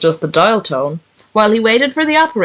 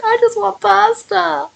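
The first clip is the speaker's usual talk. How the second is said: very high, slow little voice; very high, slow plaintive cartoon voice; tiny cartoon voice; 13 semitones higher, slower than usual. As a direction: squeak it out like a sad little cartoon. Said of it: "he's just like".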